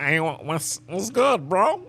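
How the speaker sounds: very high-pitched mock greeting; imitating an uncool "bro" greeting, high and rising; mock flavorless greeting. Shown as a demonstration. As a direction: pitch it way up, mimicking someone with no flavor. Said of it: "Don't be like, don't be like".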